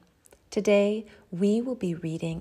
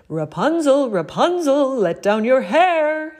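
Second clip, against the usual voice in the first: prince calling out; 8 semitones higher, 12 dB louder than usual. A narrator's reading. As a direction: call up to the tower window. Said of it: "he called".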